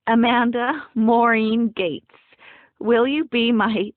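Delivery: slow, loud, solemn proclamation; mock ceremonial proposal. A narrator's reading. Bride formally asking her friend, mock-ceremonial proposal.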